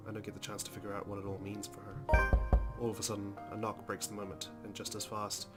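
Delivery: usual speaking voice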